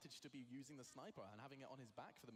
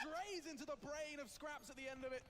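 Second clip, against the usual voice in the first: much higher, loud and drawn-out hype; excited replay call; 11 semitones higher, 8 dB louder, slower than usual. Real, not acted. Caster hyping the sniper kill for viewers, loud and slow. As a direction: stretch the call out over the replay.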